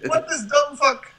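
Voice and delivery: high-pitched put-on film character; comic movie imitation. Podcaster carries on a Coming to America bit, high comic imitation. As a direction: keep the mock voice way up high.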